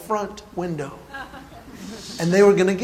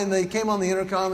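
ordinary delivery

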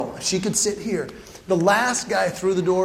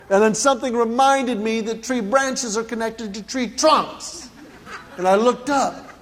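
ordinary delivery

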